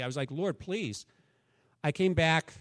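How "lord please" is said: soft, pleading plea to God; earnest prayer. Retold or imitated. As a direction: plead quietly and sincerely. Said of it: "I prayed genuinely".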